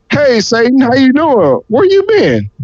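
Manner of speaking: high-pitched, playful greeting; God greeting Satan. Acted. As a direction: call out to an old acquaintance, pitch climbing high. God greets Satan, acted dialogue.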